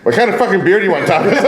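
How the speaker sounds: "What kind of fucking beer" asked high, laughing; laughing mock exasperated bartender. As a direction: tease him like a fed-up bartender. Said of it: "It's like".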